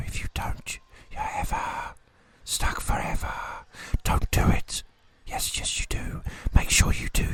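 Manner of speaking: harsh, urgent stage whisper of many voices; eerie whispers. Acted. Game master voicing dark whispers to the party, harsh urgent whisper. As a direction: hiss the warnings like arguing ghosts in the dark. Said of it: "you hear whispers in the dark".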